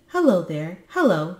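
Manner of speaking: eager double greeting, called out; calling out. A narrator's reading. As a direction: call to someone in the distance. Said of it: "he cried".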